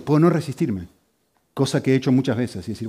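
ordinary delivery

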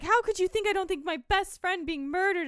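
high mock upset teen voice; mock upset girl. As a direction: squeal it like an offended teenager. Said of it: "She's like".

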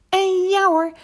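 high, loud delighted narration; excited storyteller's ta-da; triumphant. A narrator's reading. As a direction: announce the success with a big flourish. Triumph as the swing succeeds.

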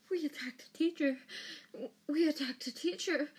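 high-pitched, frightened whimper; acted panic, pitched well up. A narrator's reading. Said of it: "Hermione whimpered".

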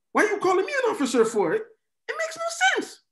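fast, high, breaking into laughter; can't get it out for laughing; laughing disbelief at calling him officer. Real, not acted. Laughing at the absurd example.

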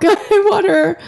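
teary laugh, high and breaking; tearful half-laugh; laughing through tears. Real, not acted. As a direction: laugh while crying. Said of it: "I sound like a big fat baby".

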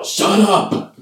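sudden high, loud outburst; imitated angry yell. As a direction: yell it out, mimicking a cartoon character losing it. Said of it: "causes [name] to immediately yell".

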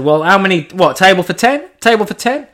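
high, rising, showy table-for-ten call; mock grand host. To his mates, mock posh booking a table. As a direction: ham up the booking with a sing-song lilt.

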